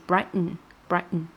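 "brighten" with T turned nasal; swallowed-T brighten. Shown as a demonstration. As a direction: glottal stop into n. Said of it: "它把这个T给弱化，变成一个鼻音".

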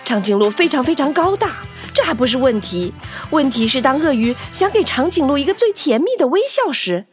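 usual speaking voice

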